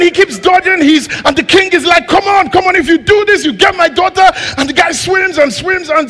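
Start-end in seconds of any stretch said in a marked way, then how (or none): 2.1-4.3 high, loud eager urging. Acted. King urging the swimmer toward his daughter, loud and high. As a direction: push him to the finish.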